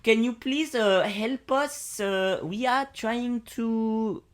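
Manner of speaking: put-on high, halting French accent. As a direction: plead as a French motorist. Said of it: "I'll be French".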